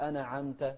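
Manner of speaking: أنعمت as "ana'amta", a vowel after n; bounced nun mistake. Retold or imitated. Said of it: "ما نقول". (أنعمت بتحريك النون)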